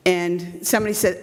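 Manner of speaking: normal talking voice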